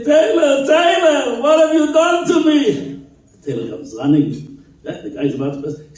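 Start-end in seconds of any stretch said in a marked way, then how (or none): 0.0-3.1 high, loud desperate cry out; anguished cry. Retold or imitated. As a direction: wail it like he is about to faint. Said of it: "He cries out".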